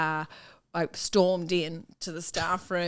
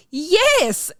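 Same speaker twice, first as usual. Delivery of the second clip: shrill yes; 10 semitones higher, 8 dB louder than usual. Retold or imitated. Teacher insisting it's hers.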